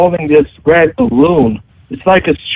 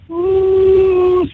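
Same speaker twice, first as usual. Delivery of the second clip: long, high spooky "ooh"; imitated eerie ooh. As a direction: imitate a creepy clown with a drawn-out ooh. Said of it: "something like that".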